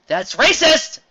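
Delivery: high, loud yelp; mock outrage. Reviewer mock-accuses the story, high loud shriek. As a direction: shriek it in fake indignation.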